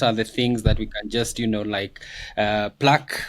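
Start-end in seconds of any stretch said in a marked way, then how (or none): none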